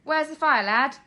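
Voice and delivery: fire chief's call. Acted. Fire chief calls to the boy, loud and brisk. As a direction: bark it like a chief rushing in.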